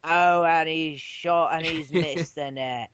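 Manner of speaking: slow drawn-out sports commentator; announcer voice. Retold or imitated. Example of the announcer he imagines, slow commentator. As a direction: call the miss like a match commentator. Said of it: "the announcer accent that I think of".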